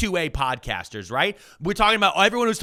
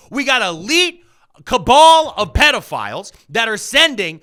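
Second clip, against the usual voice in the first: loud, higher angry outburst; furious rant; 7 semitones higher, 5 dB louder than usual. Real, not acted. Host raging at Alex Jones over the raids, loud furious rant. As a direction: yell it at him in fury.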